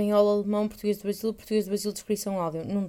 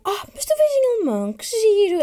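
much higher, louder gushing friend voice; excited German friend; 11 semitones higher, 7 dB louder than usual. Retold or imitated. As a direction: squeal it like a delighted friend. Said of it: "E ela ficou".

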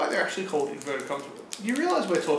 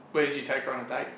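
normal talking voice

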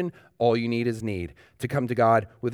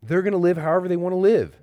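fast, louder, higher-pitched challenge from an objector; voicing the objection; 6 semitones higher, 5 dB louder, much faster than usual. Pastor voicing a skeptic's objection to grace, fast and loud. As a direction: rattle off the objection like a skeptic pushing back. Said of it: "That objection".

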